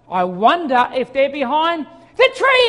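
slow playful sing-song; teasing slow. Retold or imitated. Dad teasing his boys before finding them, slow. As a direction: stretch it out to build suspense. Final playful search line.

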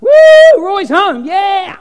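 high, loud whooping welcome; heavenly crowd cheering a homecoming, high and loud; joyful welcome cheer. Retold or imitated. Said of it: "everybody clapping".

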